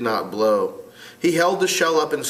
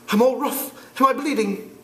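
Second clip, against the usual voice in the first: put-on higher, earnest scared boy; young boy voice; 12 semitones higher than usual. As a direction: insist like a frightened twin. [name] voiced.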